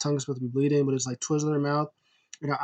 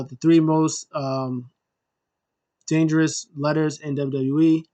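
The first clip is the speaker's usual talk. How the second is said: normal talking voice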